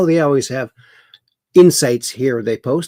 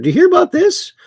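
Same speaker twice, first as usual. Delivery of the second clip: much higher, louder incredulous question; 5 dB louder, much faster than usual. Real, not acted. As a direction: toss it out in disbelief. Introducing outrageous news story.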